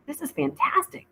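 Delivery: high, bright-eyed praise with mouth full; thrilled by a simple sandwich; delighted exclamation. A narrator's reading.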